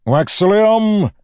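loud, high, drawn-out call. A narrator's reading. Villain calls out to his hidden nephew, loud and drawn out. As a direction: holler the name slowly across a chamber. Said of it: "Edwarn's voice called".

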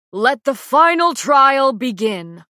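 loud, high, slow proclamation; a ruler's ringing, drawn-out call; king's announcement. A narrator's reading. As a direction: proclaim it slowly to a whole arena. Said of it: "I barely hear the king's words echo through the arena".

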